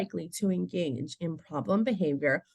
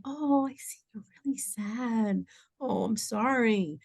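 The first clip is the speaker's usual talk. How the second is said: sympathetic comforting; 3 semitones higher, 3 dB quieter than usual. Shown as a demonstration. Adult labelling a crying child's feelings, tenderly sympathetic. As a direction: soothe a sad little child.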